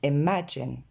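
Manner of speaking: "imagine" with two è-like sounds, eh-MA-jen; correct vowels. Shown as a demonstration. Teacher modelling deux fois le son E, correct pronunciation. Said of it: "Encore une fois".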